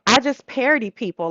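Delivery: ordinary delivery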